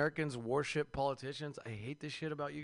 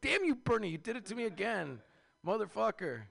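mock outraged curse; 6 semitones higher than usual. Cursing Bernie after being tricked.

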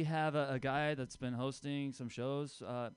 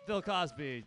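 hype-man shout; 7 semitones higher than usual. Real, not acted. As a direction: pump up the crowd. Hyping crowd applause.

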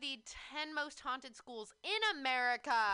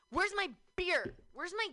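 hammed-up angry demand; mock mad boys; 4 semitones higher, 4 dB louder than usual. Woman mimicking boys who get immediately mad, mock angry. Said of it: "See, boys are immediately mad".

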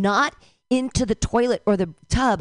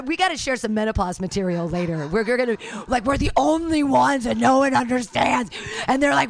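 ordinary talk, nothing marked

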